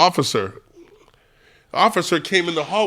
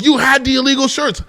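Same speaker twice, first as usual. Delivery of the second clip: imitating an exasperated officer, pitched up; mock indignant outburst; retold accusation; 7 semitones higher, 3 dB louder than usual. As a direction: accuse in a raised, imitated cop voice. Officer accuses him in retelling.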